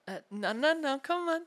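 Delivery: loud, high, urgent no; urgent protest. Acted. Captor stopping an escape attempt, loud urgent protest. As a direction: call out to stop them, rising high.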